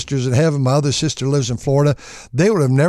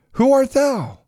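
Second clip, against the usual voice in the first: put-on startled old father; 12 semitones higher, slower than usual. Isaac startled.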